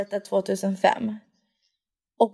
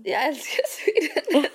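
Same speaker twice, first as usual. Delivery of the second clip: higher, drawn-out teasing echo; playful mimicry; 5 semitones higher, slower than usual. Echoing the co-host's declaration of love for Sweden, playful mimicry. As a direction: parrot it slowly, breaking into laughter.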